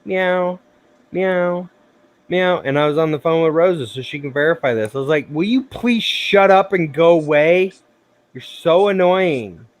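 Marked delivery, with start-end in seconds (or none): 0.1-2.6 high, repeated imitation cat meow. Imitating the yard cat that came up, insistent meowing. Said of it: "she just kept looking at me". 5.3-9.7 higher, louder exasperated outburst; whiny fed-up retort, slowing at the end. Retold or imitated. Retelling shooing away a yard cat, exasperated.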